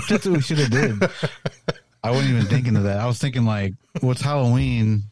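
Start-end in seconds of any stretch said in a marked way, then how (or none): 0.0-1.0 fast, laughing through words; giggly, high and quick. Real, not acted. Laughing at flashlight idea.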